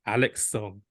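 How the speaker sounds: normal talking voice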